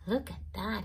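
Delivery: ordinary delivery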